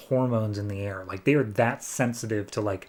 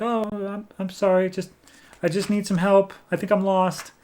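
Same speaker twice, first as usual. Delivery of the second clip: put-on higher, faster little girl asking at the door; little girl's voice; 7 semitones higher than usual. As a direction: play a lost little girl, pitched up and quick. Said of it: "a little girl's voice at the door".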